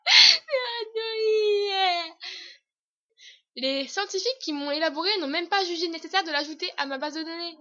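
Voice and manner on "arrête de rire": laughing while speaking; giggling through the words. Real, not acted. Cracking up mid-take. (en riant)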